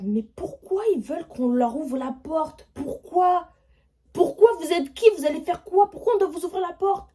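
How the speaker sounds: retold frightened questioning; panicked questions. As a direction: demand answers in a panic. Panicked questions through door.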